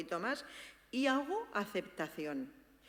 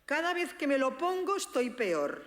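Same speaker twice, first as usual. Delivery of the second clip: complaining; 6 semitones higher, 5 dB louder than usual. Retold or imitated. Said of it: "dice Antonio".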